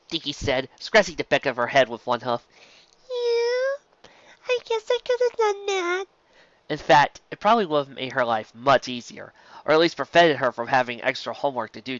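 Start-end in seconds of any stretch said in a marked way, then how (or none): 3.1-6.0 high, slow little filly voice; young filly voice. A narrator's reading. Dinky answering her teacher in a high filly voice. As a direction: play a small shy filly.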